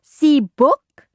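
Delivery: put-on high, loud little-kid voice; eager toddler. A narrator's reading. Toddler shows the guests his book, eager and high.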